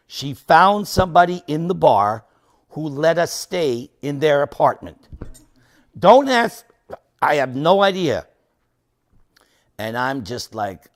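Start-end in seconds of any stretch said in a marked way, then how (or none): 6.0-8.3 loud, higher throwaway aside; emphatic bafflement. Real, not acted. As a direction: punch it out, totally at a loss.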